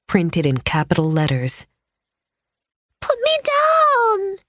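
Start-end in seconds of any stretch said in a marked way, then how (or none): 3.0-4.4 put-on high, slow little girl yell; yelling child. A narrator's reading. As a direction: scream it like a thrashing child. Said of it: "Sarah yelled".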